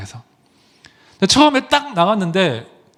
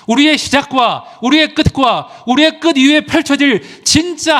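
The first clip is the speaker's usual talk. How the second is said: full-force preaching; 7 semitones higher than usual. Acted. Pastor urging the congregation to proclaim the truth, fervent preaching. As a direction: preach it out, rising and insistent.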